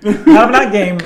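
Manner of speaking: laughing loudly through the words. Real, not acted. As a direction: laugh as you list them out. Laughing at the rating scale.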